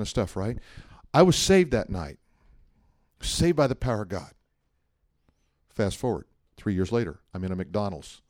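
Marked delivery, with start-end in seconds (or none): none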